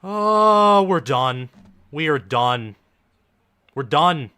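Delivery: loud, high groan of defeat; dismayed outburst. Real, not acted. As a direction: cry out that the game is lost. Fan watching team lose.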